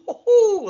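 high, loud hoo-hoo whoop. Real, not acted. Host cheering his own rhyme, loud high whoop. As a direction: whoop at your own joke.

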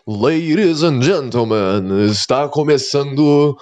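slow, grand show announcer; carnival barker. Pretend. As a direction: stretch it out like a circus ringmaster.

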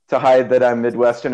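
normal talking voice

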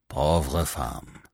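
a soft, low murmur in French; murmured to himself. A narrator's reading. Commissary murmurs to himself after the fainting widow, softly. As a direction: pity her under your breath. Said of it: "he murmured to himself".

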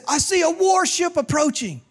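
high-pitched lookout's call; hollering. Acted. Lookout reports to the captain below, hollering. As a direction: call it down from the mast. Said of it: "he hollered out".

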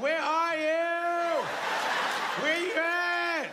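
slow, high, drawn-out fake call; stalling while the wife paddles, faking a search; mock searching. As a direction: pretend you can't see her.

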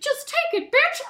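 very high falsetto command with chuckle; bossy squeaky cartoon mouse, loud giggle after; Mickey Mouse. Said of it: "B D S M Mickey Mouse".

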